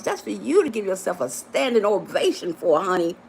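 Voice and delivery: usual speaking voice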